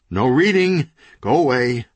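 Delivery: loud, high frantic yelling; shouting. Acted. Owner chasing readers away, frantic shouting. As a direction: drive the crowd out with a shout. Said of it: "shouted the owner".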